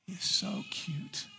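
very high and hushed, drawn out slowly. Real, not acted. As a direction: coo it to the newborn like a delighted grandparent. Cooing at a newborn in church.

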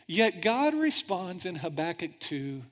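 usual speaking voice

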